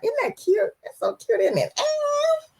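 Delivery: high, soft "isn't that cute"; cooing. Real, not acted. Host cooing at viewer's background, high and soft. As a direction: coo over it softly, way up high.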